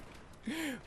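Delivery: high-pitched breathy laugh. Real, not acted. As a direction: squeak out a laugh. Laughter after retold panic.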